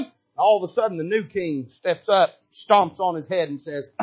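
ordinary talk, nothing marked

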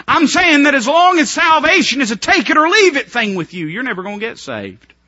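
ordinary delivery

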